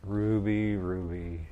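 low, hushed repeat of a wife's name; saying to wife. Pretend. Husband imagining himself addressing his wife, soft and low. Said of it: "imagine myself saying to my wife".

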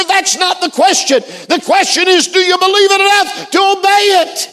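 high, pressing sermon delivery; fervent preaching. Real, not acted. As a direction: press the challenge on the congregation. Preaches at full force.

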